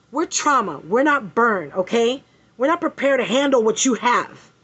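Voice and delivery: hammed-up nurse brushing off a burn patient; mock curt nurse. Mocking the trauma nurse who refused her. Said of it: "her response was".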